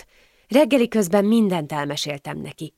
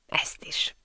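a breathy whispered afterthought, barely voiced; whispered aside; 9 dB quieter than usual. Jealous man whispers his afterthought to Anna, whispered. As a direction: let it slip out as a jealous whisper.

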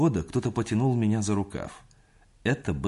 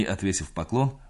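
usual speaking voice

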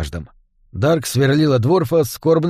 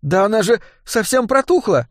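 put-on disgusted girl voice; 8 semitones higher than usual. A narrator's reading. Dark disgusted by rat.

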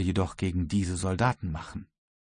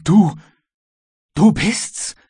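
high, loud exclamation; astonished cry; 10 semitones higher, 7 dB louder, slower than usual. A narrator's reading. Halliburtt recognises his servant, crying out in astonishment. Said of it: "rief Mister Halliburtt".

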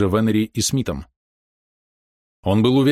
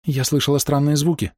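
feverish, higher-pitched urgency; 6 semitones higher than usual. A narrator's reading. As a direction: press on in agitation. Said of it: "лихорадочно продолжала Ида". (лихорадочно, взволнованно)

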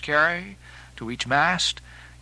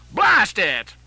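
loud, high burst of annoyance; sharp shouted curse; cursing cry; 5 semitones higher, 6 dB louder than usual. Acted. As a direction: shout the oath as if a hand got jammed. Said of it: "cried Stubb at this instant".

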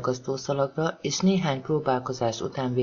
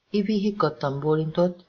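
usual speaking voice

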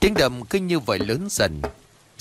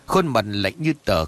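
usual speaking voice

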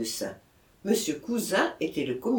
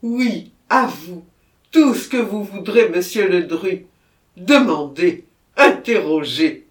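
loud, pleading offer; eager surrender; 9 dB louder than usual. A narrator's reading. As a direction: throw himself open to questions. Murderer eagerly yields to mayor.

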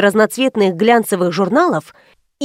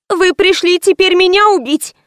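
fearful; 8 semitones higher than usual. A narrator's reading. Frightened girl accuses her visitor.

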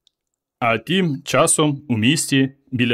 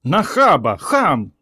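shrieking aunt; 4 semitones higher, slower than usual. A narrator's reading. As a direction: squeal it like an offended beach aunt. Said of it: "Одна огрядна тітка заверещала".

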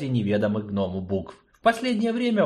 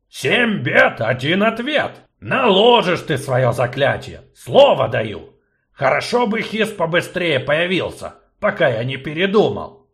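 blustering dwarf, louder and higher; gruff gnome, loud; 6 semitones higher, 6 dB louder than usual. Acted. Gnome agreeing to the spell, loud bluster. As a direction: gnome daring himself, booming.

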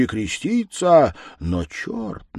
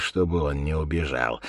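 usual speaking voice

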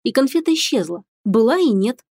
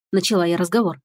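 plain reading voice

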